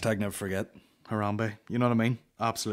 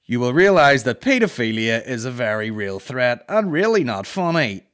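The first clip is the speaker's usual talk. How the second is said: louder, prim, offended letter reading; indignant mum voice; 7 dB louder than usual. Retold or imitated. Comedian reading Marion's email, indignant. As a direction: read it like a scandalised mother.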